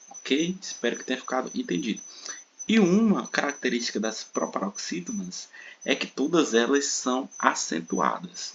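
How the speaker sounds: ordinary talk, nothing marked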